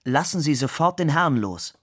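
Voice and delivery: commanding call. A narrator's reading. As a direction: call out a firm order to the waiters. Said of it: "rief den Kellnern zu". (energisch gerufener Befehl)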